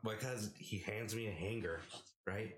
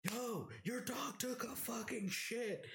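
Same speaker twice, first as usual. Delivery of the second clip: high slow yell across the room; retold yelling; slower than usual. Yelling at roommate about the dog mess, high slow yell. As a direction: bellow it so he hears over the noise. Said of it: "screaming at my roommate".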